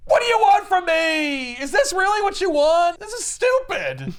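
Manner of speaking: high, loud mock tantrum; exasperated rant. Young Thanos demanding answers from Death, high shouted exasperation. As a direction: shout it in hammed-up frustration.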